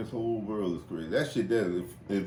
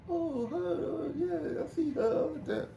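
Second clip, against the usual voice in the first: high mock old man. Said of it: "old man voiced".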